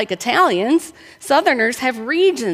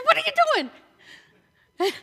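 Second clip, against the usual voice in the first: very high-pitched, incredulous protest; mock outrage; 4 dB quieter, much faster than usual. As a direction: squeal it in hammed-up horror at ruined food. Retold outrage at barbecue cooks.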